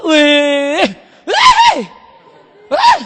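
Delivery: high, loud drawn-out squeal; shrieked "wih". Acted. Child shows dad a love letter, loud high shriek. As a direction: shriek a long, piercing "wiiih".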